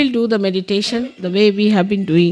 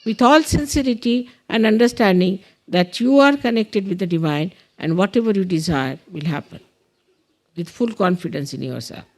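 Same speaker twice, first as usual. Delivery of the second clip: deliberate prayer leader with long pauses; measured meditation instructions with space to follow; guided meditation; slower than usual. Real, not acted. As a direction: guide them through it, phrase by phrase.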